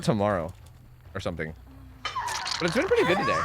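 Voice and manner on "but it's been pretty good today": lilting high singsong. Acted. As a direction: sing it up high and light. Cheerful singsong status update.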